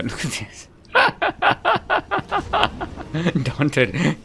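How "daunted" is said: high, giggling laughing fit; real high-pitched laughter breaking up the words; cracking up. Real, not acted. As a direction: laugh helplessly, squeezing the name out between bursts. Cracks up at the game.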